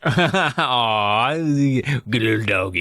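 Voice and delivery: slow, gooey cooing; doggy baby talk. Pretend. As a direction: coo at a sweet little dog. Cooing callback to pet talk.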